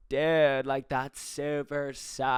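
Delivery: slow, stretched-out chill dude; really chill tone. Retold or imitated. Imitating the LA Uber driver's intonation, really chill tone. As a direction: imitate a laid-back stoner, dragging it out. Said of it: "really chill tone of".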